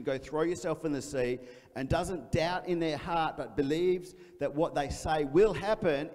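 preaching the promise boldly; scripture read with force. Acted. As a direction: proclaim the verse to the congregation. Reading scripture aloud with force.

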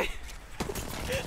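high breathy cackle; gleeful laugh. Real, not acted. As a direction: cackle in triumph. Laughing after a kill.

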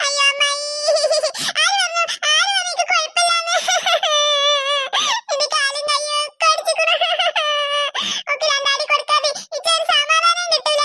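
hammed-up bawling; crying. Child insisting to her aunt that she is hurt, crying. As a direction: wail out the grievance, sobbing between phrases. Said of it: "പിന്നെ എന്തിനാ വെറുതെ ഇരുന്ന് കരയുന്നത്?".

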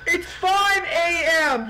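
drawn-out loud cry; loud, slow exclamation. Real, not acted. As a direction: shout it out slowly. Urging himself through the night.

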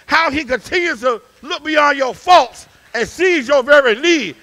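loud preaching climbing to shouts; preaching at full force. Acted. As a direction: whoop it up to the congregation. Preacher final shouting build.

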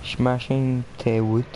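ordinary talk, nothing marked

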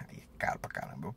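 hushed whisper about the price; whispered aside. Real, not acted. Car owner whispering to viewers how expensive the oil is. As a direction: breathe it out like a secret.

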